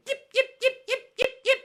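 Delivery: very high, loud "yip, yip" bird calls; imitated yips. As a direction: shriek the yips like a strange bird. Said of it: "I've never heard a chicken go".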